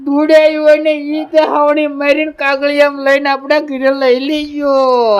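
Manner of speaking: hammed-up tearful wail to uncle; mock sobbing lament. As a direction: sob through the complaint, dragging out the ending. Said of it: "રોવું નથી".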